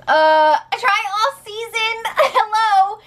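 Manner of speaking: high, disbelieving "Hello?"; high-pitched are-you-kidding-me; incredulous hello. Real, not acted. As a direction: call out the absurdity. Incredulous at houseguest.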